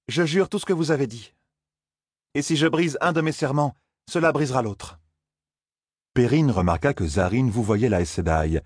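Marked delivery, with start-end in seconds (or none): 0.1-5.0 higher, earnest vow. Acted. Zarine vowing to Moiraine, higher and earnest. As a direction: commit with a proud, lifted voice.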